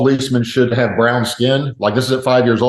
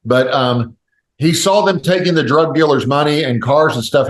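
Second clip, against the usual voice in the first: normal talking voice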